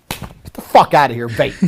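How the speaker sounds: usual speaking voice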